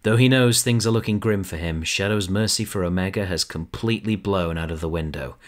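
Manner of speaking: normal talking voice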